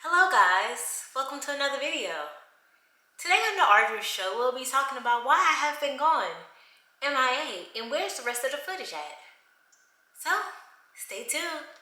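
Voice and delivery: soft, mock host intro; mock show intro. Pretending to open her show like a presenter. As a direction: play the TV host for a moment. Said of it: "I'm just kidding".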